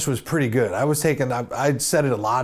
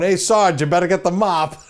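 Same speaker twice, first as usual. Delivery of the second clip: cop calling out; 5 semitones higher, 5 dB louder than usual. Retold or imitated. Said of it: "I'll never forget the cop going".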